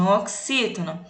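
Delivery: normal talking voice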